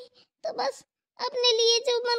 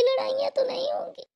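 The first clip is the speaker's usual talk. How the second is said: whispered lament; 3 semitones higher than usual. Acted. Upset sister lamenting.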